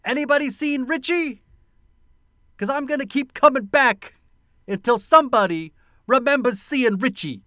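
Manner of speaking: high, loud Brooklyn tough guy; Brooklyn accent. Retold or imitated. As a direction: threaten in a hammed Brooklyn accent. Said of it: "when he says".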